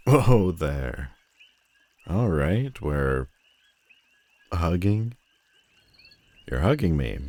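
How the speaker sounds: taken aback by a sudden hug; caught off guard. Acted. Villain surprised when the hero hugs her, startled.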